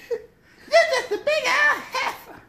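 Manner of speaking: very high, slow and laughing; high mocking voice. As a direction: stretch the insult out high. Quoting movie line.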